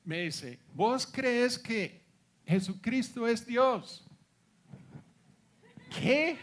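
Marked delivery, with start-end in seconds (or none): 0.7-4.1 a very slow, louder question, each word weighed; slow earnest question. Retold or imitated. The stranger on the beach asks [name], slow and earnest. 5.9-6.4 a sudden, high, loud "¿Qué?" of disbelief; shocked what. Real, not acted. Hearing the stranger's question, a high loud startled what.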